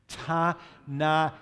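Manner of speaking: "Tanaim" loud and spaced out, Ta, na, eem; syllable-by-syllable demo. Shown as a demonstration. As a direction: break "Tanaim" after each vowel for the class to repeat. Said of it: "The syllable turns on a vowel".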